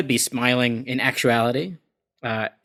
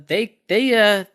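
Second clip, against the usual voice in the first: usual speaking voice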